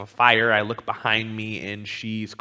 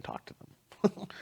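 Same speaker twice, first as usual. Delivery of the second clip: breathy high mock sob of talk to them; a hint of tearful begging, slow; imitating crying plea; 9 semitones higher, 10 dB quieter, slower than usual. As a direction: whisper it like a desperate plea. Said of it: "She's crying on the floor".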